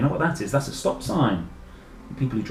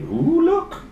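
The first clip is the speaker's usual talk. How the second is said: excited; 4 dB louder than usual. Real, not acted. Child audience, spotting new tools.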